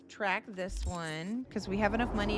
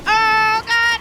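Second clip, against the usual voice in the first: sudden loud high cry of shock; startled yell; 12 semitones higher, 17 dB louder than usual. Real, not acted. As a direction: yell out as the car spins. Crashing the car in game.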